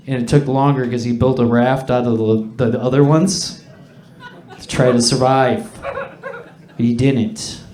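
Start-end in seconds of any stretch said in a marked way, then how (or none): none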